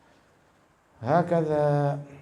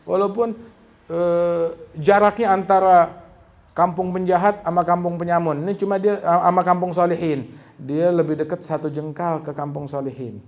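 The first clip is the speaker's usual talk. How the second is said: forceful preaching; 4 semitones higher, 8 dB louder than usual. Real, not acted. Preacher presses his point hard.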